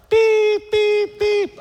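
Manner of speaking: high, loud electronic beeps; imitated machine beep. Imitating the monitor that keeps him awake. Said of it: "this noise happens every fifteen seconds".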